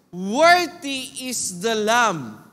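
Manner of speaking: "Worthy" drawn out very slowly and loud; slow, ringing proclamation; preacher modelling the chant for the congregation, loud and slow; proclaimed. Shown as a demonstration. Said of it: "saying with a loud voice".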